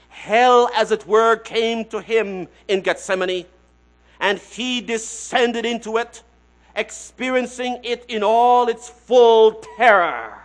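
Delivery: loud, slow, weighty reading; emphatic quote. Acted. Preacher reading a theologian's words to his congregation, loud and slow. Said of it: "Doctor Hendrickson says this".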